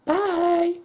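high sing-song bye; sing-song goodbye. Real, not acted.

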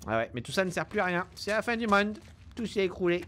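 ordinary talk, nothing marked

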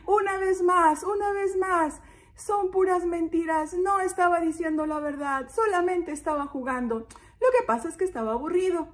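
put-on high-pitched prankster boy; little shepherd boy. Shepherd boy admitting he was only playing, high voice. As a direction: play the boy owning up to his joke, high. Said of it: "dijo el pastorcito".

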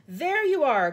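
high, loud, triumphant call. A narrator's reading. Police chief proved right to his colleague, calling out. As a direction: call out that you were right. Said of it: "called the chief of police".

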